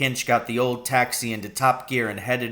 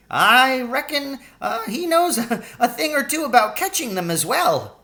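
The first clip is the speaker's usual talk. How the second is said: put-on sly old Charlie; 12 semitones higher, 3 dB louder than usual. Old cabbie hinting about the policeman, higher chuckling voice. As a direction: let a laugh slip into the sly old cabbie.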